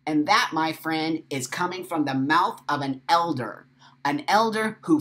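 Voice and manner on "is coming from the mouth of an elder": ordinary talk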